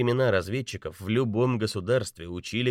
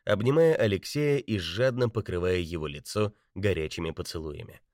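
ordinary delivery

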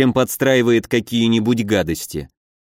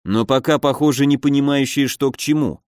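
ordinary delivery, nothing marked

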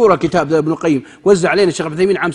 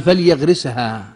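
"فليغرسها" said slowly and softer; slow calm answer; 6 semitones lower, 5 dB quieter, much slower than usual. Retold or imitated. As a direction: draw out "فليغرسها" gently. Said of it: "قال". ("فليغرسها" ببطء وهدوء)

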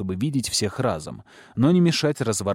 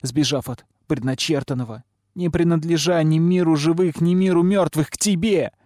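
high, tearful reproach; weeping girl's impassioned plea; sobbing lament; 7 semitones higher, 3 dB louder than usual. A narrator's reading. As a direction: lament accusingly, climbing in pitch. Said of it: "рыдало пламя".

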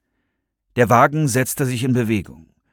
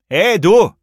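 loud, raised-pitch hail; calling out; 9 semitones higher, 7 dB louder than usual. A narrator's reading. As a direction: butt in with a shout. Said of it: "rief eine dunkle Stimme dazwischen".

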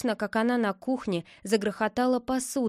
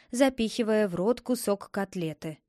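usual speaking voice

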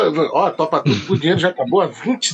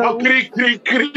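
high mock cricket chirping; cricket chirps; 5 semitones higher, much slower than usual. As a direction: chirp like crickets after a dead joke. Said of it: "mimics crickets after flat joke". (imitação de grilos, aguda e zombeteira)

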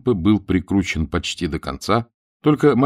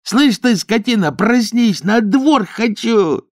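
high-pitched, enraged urgent yelling; insistent demand; 14 semitones higher, 4 dB louder, slower than usual. A narrator's reading. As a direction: shake a sleeper awake with shrieked demands. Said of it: "настойчиво требовал".

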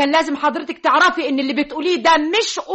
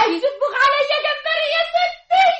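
frightened wail; 11 semitones higher than usual. Acted. Maid wails in fear.

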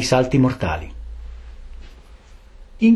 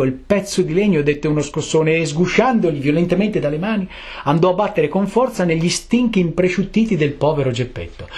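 ordinary delivery

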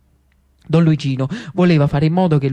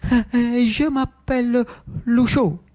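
higher, halting timid reply; hesitant Lucio; 6 semitones higher, slower than usual. Acted. Lucio giving his name to Riccardo, hesitant high voice. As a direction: stammer it out slowly, pitched up.

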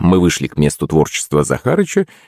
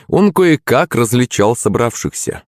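ordinary delivery

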